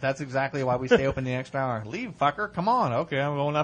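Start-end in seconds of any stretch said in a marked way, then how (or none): 1.9-2.9 mock shout. Mock kicking friend out.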